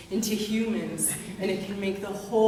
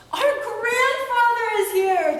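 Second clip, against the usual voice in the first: much higher, louder excited cry; excited shout; 13 semitones higher, 5 dB louder than usual. Acted. Brothers shouting the news of their grandfather, high and loud. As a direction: call out in delight. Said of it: "the brothers shouted".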